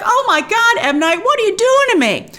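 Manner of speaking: high, loud exclaiming; mock dismay. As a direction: cry out at the director in mock despair. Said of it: "And I was like".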